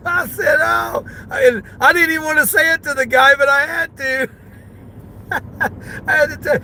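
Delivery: high, laugh-choked telling; real laughter in the voice; laughing while talking. Real, not acted. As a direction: talk through the giggles.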